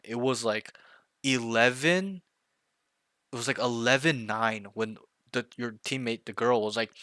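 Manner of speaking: ordinary talk, nothing marked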